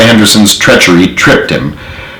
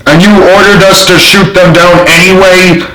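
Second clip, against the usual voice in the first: higher, thundering accusation; thundered; 8 semitones higher than usual. A narrator's reading. Sergeant thundering at the general, higher and furious. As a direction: thunder the charge at him. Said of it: "Thompson thundered".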